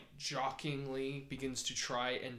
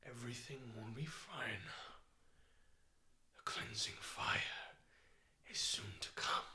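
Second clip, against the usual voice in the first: eerie whisper; 4 dB quieter than usual. Acted. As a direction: breathe it like a menacing spirit. Spirit speaks to its victim.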